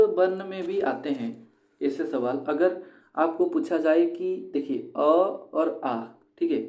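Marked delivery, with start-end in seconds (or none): 5.0-6.1 अ और आ said very slowly; drawn-out vowels. Shown as a demonstration. Teacher slowly sounding out the vowels अ and आ. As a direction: stretch अ and आ out clearly. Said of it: "कंठ से उच्चारित करते हैं".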